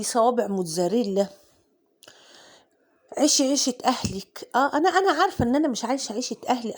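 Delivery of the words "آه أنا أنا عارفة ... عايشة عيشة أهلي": fast self-mocking laugh. Real, not acted. Admitting to viewers she lives beyond her means, laughing fast. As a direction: laugh at yourself, rushing the words.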